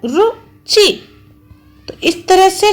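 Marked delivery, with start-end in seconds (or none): 0.0-1.1 रुचि stretched very slowly and pitched higher; रुचि very slow, sung-out syllables; drawn-out teaching demo. Shown as a demonstration. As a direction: stretch रुचि out so pupils hear each vowel. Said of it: "च में भी छोटी ई का स्वर निकल रहा है".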